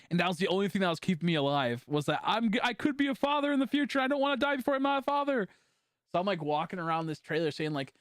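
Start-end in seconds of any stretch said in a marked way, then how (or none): 2.2-5.5 high, strained retold plea; desperate plea. Man pleading to stay alive, desperate. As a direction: plead in a raised voice, acting it out. Voicing his desperate past thought.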